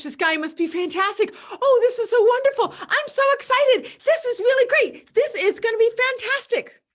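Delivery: very high, loud giddy delight; thrilled. Pretend. A listener thrilled by the pitch, giddy and high. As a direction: squeal with excitement. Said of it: "I have taken what the person has said as true".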